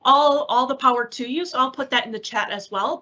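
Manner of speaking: ordinary delivery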